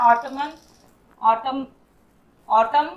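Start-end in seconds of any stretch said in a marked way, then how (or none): 1.2-2.9 "ऑटम" ending on m, no n sound; silent n. Shown as a demonstration. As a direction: say "ऑटम" with the final n dropped. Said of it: "इसे ऑटमन नहीं पढ़ते हैं". ("ऑटम" में न का उच्चारण नहीं)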